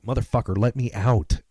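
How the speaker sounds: yelled from behind the curtain. Retold or imitated. Friend trapped in the confessional yelling at him, loud. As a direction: bellow to be let out. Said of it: "he's behind me like".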